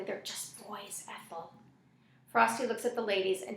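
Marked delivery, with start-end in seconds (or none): 0.0-1.5 slow, hushed plea; gentle, much quieter appeal; soft. A narrator's reading. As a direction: say it very softly and slowly. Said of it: "It says softly".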